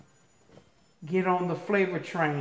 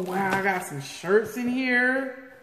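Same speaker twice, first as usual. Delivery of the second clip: usual speaking voice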